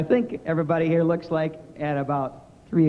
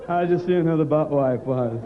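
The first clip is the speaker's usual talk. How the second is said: laughing; 4 semitones lower, slower than usual. Retold or imitated. Retold reply to an insult.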